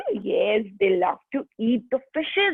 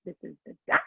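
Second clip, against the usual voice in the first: low grown-up duck; 4 semitones lower, 3 dB quieter, much faster than usual. Shown as a demonstration. Teacher showing children the adult duck, lowered voice. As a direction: lower pitch for the big duck.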